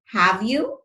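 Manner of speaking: "have you" lifting upward at the end; rising question tag. Shown as a demonstration. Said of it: "the way I say have you takes a rise".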